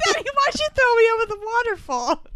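high put-on child's voice; mock little girl. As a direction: whine it like a wronged kid.

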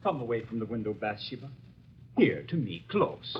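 hushed, intimate coaxing; soft coaxing. Acted. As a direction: draw her close in a low hush. Lover coaxing Bathsheba close.

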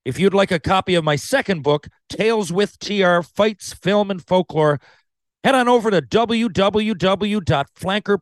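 upbeat promo read; bright sponsor plug, high. Acted. Podcast ad read.

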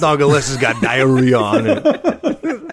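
put-on slow sing-song New York accent. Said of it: "I'm doing a New York accent for Chicago".

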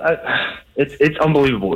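ordinary delivery

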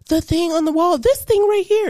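little sister insisting. Retold or imitated. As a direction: insist like a kid, voice up. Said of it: "She's like".

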